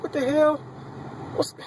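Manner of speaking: incredulous high-pitched exclamation; shocked disbelief. Retold or imitated. Patient hearing he was assaulted, shocked disbelief. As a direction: voice leaping very high.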